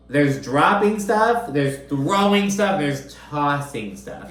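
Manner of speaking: slow list leaning on "dropping", "throwing", "tossing"; stressed distinction. Shown as a demonstration. Host spelling out the difference between verbs, stressing "dropping", "throwing", "tossing". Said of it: "I'm really big on that distinction".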